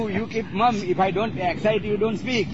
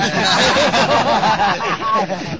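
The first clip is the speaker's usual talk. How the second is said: long, high burst of laughter; hearty laugh; 3 semitones higher than usual. Real, not acted. Disciple laughing while being corrected by his guru, hearty. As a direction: laugh out freely.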